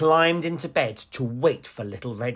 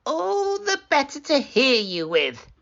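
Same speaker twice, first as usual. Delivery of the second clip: much higher sly granny; wolf as grandmother; 3 dB louder than usual. Acted. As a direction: answer sweetly with menace.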